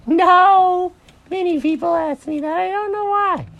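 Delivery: very high cartoon bunny, loud no; high-pitched, clueless rabbit voice; Mary the rabbit. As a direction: squeal a denial, then puzzle over it. Mary's put-on rabbit voice.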